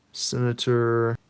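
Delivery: ordinary talk, nothing marked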